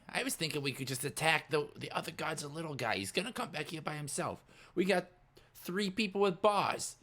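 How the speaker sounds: high-pitched character voice; scheming Scooter. Acted. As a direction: pitch the plan in a high sidekick voice. Scooter proposing a plan.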